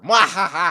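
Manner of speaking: loud hammy villain cackle; evil laugh. Retold or imitated. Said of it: "he's like".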